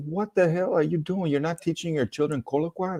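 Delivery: high, indignant scolding of the parents; mock scolding, pitched well up; mock outraged scolding. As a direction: raise the pitch in disbelief at them.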